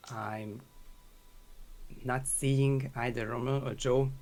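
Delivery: hoarse from talking for hours; worn-out hoarse voice. Real, not acted. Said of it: "losing my voice over talking".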